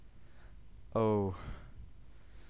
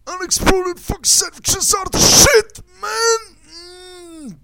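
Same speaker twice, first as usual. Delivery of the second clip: shrieked very high and much louder; screaming; 13 dB louder than usual. Acted. Ranting comic screams at unexploded sandwiches, shrieking. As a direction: scream it out at the top of the voice.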